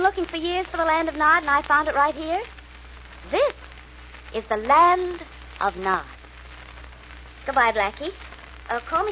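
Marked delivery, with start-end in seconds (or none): 3.3-6.1 slow, with "Nod" weighted as a hidden hint; stress on Nod. Acted. Captive girl hinting to Blackie, slow with weight on "Nod". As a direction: lean hard on "Nod" so he catches the clue. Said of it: "she emphasized Nod".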